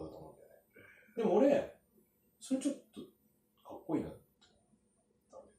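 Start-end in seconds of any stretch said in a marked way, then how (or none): none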